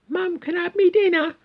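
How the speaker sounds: very high mock child voice; squeaky falsetto little lad; little boy. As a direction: pipe up like a kid asking mum. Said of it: "little Tommy saying to his mum".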